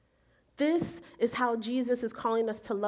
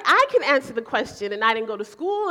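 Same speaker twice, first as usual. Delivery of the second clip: louder, exasperated aside; indignant inner rant; 8 dB louder than usual. Retold or imitated. Annoyed customer ranting in her head, much louder. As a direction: huff it out like you could do the job.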